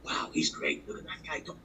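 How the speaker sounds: hushed, breathless admiration; awed whisper. Retold or imitated. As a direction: whisper it like a starstruck fan.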